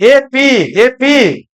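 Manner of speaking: teacher slowly drawing out "एपी" so students catch it; drawn-out syllables. Shown as a demonstration. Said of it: "आ एपी भी आ जाएगा".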